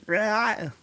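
a quick high sung "rah" like the singer; brief singer impression. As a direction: mimic the singer's vowel. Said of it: "the way he sings it".

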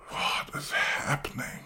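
whispered, confused aside; puzzled whisper to yourself; baffled whisper. Retold or imitated. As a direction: hush it like a secret panic. Baffled inner thought at deathbed.

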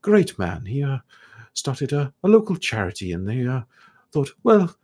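put-on high, airy voice of a curious old elf scholar; scholarly elf ghost. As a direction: play the puzzled, polite ghost scholar, pitched high. Ghost about a skull.

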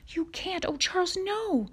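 high-pitched, anguished protest; desperate pleading. A narrator's reading. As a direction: beg him in rising alarm. Ma desperately begs Pa.

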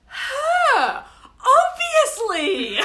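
high, loud and drawn out, hammed-up; exaggerated outburst. Friend Liam choosing his best friend, hammed-up and loud. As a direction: fling the answer out like it's obvious. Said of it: "And he goes".

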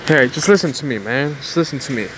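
ordinary talk, nothing marked

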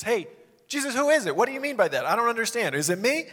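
loud, high, insistent questioning; confident outright asking. Acted. Disciple openly asking Jesus who will betray him, loud and insistent. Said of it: "didn't have the confidence to just ask".

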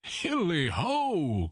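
long, high merry holler; Fezziwig. A narrator's reading. Master rallying his lads, long high jovial cry. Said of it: "cried old Fezziwig".

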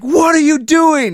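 high, slow, very loud mock shriek; imitated alarmed yell. Friend seeing him drop down a ledge, alarmed yell. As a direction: shout it in exaggerated horror. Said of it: "And John was like".

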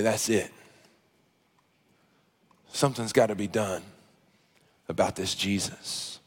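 very low and soft, with heavy pauses; grim, quiet resolve. Retold or imitated. Preacher as the Pharisees resolving against Jesus, quiet and grim. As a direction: drop right down as if plotting.

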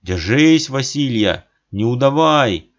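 громко подбодрить; крикнуть ободряюще; подбадривает. A narrator's reading. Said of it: "отец подбадривает". (громкое ободрение)

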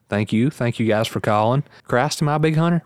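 usual speaking voice

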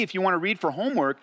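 normal talking voice

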